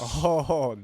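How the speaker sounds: a loud, real burst of surprise; sudden loud gasp of delight; surprised oh. Real, not acted. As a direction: jolt out a loud oh. Reacts to a voice line.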